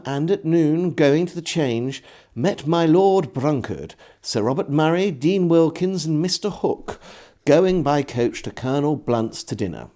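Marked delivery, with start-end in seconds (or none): none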